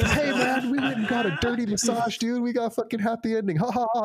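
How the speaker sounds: high, giddy gloat with mock laughter; drunk gloating. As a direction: taunt him like a drunk college kid. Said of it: "And we're all laughing".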